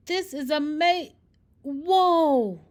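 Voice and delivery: high, loud, cut-off yelp of alarm; startled cry. Acted. Bernard, mid-flight, yelps in surprise as he falls. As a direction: break off mid-word as you lose control. Bernard tries flying and crashes.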